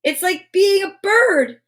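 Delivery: high, slow and dreamy delight; slow, awed wonder. Acted. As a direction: savor the feeling of flying. Ariel marveling in balloon.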